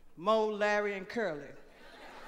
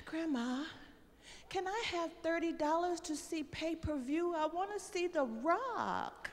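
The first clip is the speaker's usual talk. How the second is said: begging granddaughter; 6 semitones higher, 6 dB quieter than usual. Retold or imitated. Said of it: "she come walking up to me".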